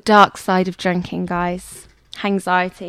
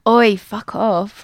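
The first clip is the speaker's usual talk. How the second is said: failed Essex attempt; 6 dB louder, much slower than usual. Shown as a demonstration. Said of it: "Imagine I go".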